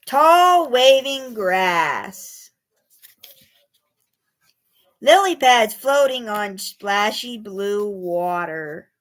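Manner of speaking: slow, gentle reading; poem for children. A narrator's reading. Reading a pond poem to young children, slow and gentle. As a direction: savour each image slowly.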